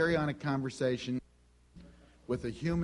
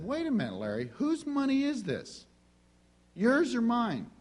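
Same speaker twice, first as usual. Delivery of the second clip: much higher, questioning voice of the Spirit; Holy Spirit; 9 semitones higher than usual. Retold or imitated. The Holy Spirit challenges Larry over the money, high questioning voice. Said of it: "the Holy Spirit said".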